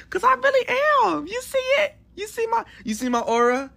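high, loud sassy teasing; playful sassy brag. Acted. Boasting to the friend, he teases high and sassy. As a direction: show off your glow, high and sassy. Playful bragging after compliment.

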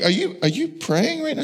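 high, hushed mock disbelief; high-pitched baffled husband; incredulous question. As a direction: ask it in a small high voice. Teasing wife mid-movie.

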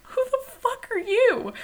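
high, squeaky mock-rude question; mock alarmed. Host imagining telling delivery man off, high mock outrage. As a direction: pretend to snap at the stranger. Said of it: "I didn't say that".

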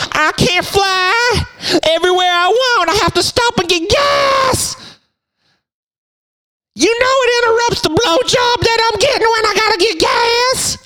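a mock televangelist begging the flock for jet money; televangelist impression. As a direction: ham it up as a greedy TV preacher. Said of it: "I like what the televangelists do".

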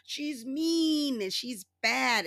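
put-on high, slow childish whine; childish mock Trump. Mocking Trump's reasoning to her viewers, high and slow. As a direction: drag it out like a sulking child. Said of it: "He's just".

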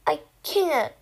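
plain reading voice

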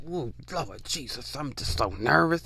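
hammed-up jittery admirer, barely above a breath; imitating a fan too nervous to speak; mock nervous fan. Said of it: "they're just like".

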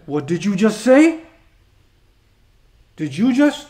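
mock-affronted challenge; indignant, drawn-out questioning; arrogant. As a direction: act out arrogant disbelief at what was said. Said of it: "this is an expression for arrogance".

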